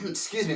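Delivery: clears a dry throat; rough throat clear before speaking. Real, not acted. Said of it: "my throat is a little".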